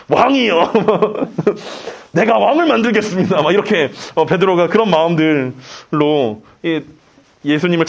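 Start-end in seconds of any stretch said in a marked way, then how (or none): 0.1-3.4 hammed-up vow broken by laughter; laughing mock declaration. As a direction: declare it grandly, then crack up laughing. Acting Peter, breaks into laughter.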